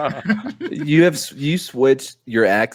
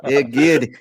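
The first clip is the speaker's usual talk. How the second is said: normal talking voice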